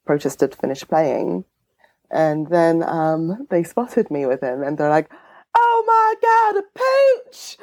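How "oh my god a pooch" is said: high, excited mock Southern squeal; put-on Southern accent. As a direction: gush over a dog in a put-on Southern accent. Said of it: "that's the accent I'm going to do today".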